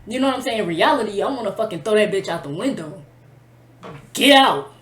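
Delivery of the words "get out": loud, forceful command; retold angry order. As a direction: order someone out at full voice. Imagined order to throw her out.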